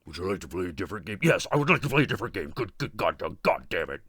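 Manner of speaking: low, soft, fast rattled-off voice; mechanical tape voice. Retold or imitated. Jigsaw's VCR game talking to the slasher, low and hurried. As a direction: rush it like a worn VCR tape.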